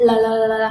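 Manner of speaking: loud repeated "L"; loud L practice; loud L drill. Shown as a demonstration. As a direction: hit each "L" loudly. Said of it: "Sửa âm L thì là L".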